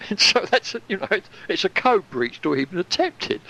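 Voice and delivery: laughing. Real, not acted. Laughing at the absurd code breach.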